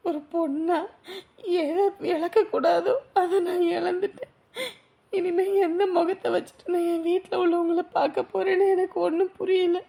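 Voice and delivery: weep out the fear of shame; sobbing despair. Acted. Girl crying over lost honour. (காதலனிடம் அவமானத்தை நினைத்து அழுது புலம்புதல்)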